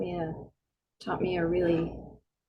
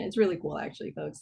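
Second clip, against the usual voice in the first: usual speaking voice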